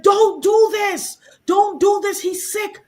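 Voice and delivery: high, loud pleading cry of don't do this; pleading shout. Retold or imitated. Defending him against the internet, a high pleading shout. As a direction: beg them to stop, voice up high.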